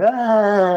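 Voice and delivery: high, drawn-out mock cry; imitated boy's wail. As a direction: wail "ah" like the stricken boy. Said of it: "I hear the boy going".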